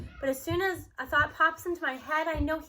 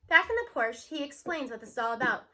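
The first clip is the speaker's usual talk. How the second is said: usual speaking voice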